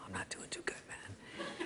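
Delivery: breathless, barely voiced whisper; weak whisper. Pledge whispers to his brother, faint and weak. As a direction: whisper it like you're fading from the heat. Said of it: "And I go".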